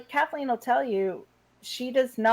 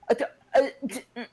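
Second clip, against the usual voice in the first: flustered stammer; much faster than usual. Retold or imitated. Acts out being caught off guard.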